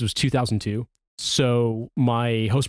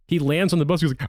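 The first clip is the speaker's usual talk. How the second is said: ordinary delivery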